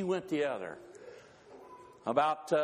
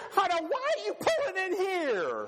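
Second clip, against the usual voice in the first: wife's voice. Acted. Wife objecting as husband pulls into K F C. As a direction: protest the restaurant pick. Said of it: "she says".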